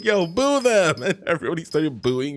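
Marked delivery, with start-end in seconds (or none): 0.0-1.0 high slow loud boo them; rallying call. Pretend. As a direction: whip the crowd into booing. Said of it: "if I was like".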